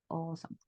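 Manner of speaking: "Awesome" said as "osom"; correct pronunciation. Shown as a demonstration. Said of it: "Así lo vamos a pronunciar".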